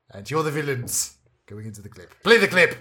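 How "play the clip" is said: loud, higher call to roll it. Acted. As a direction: call it out like a show host. Host calls for the clip.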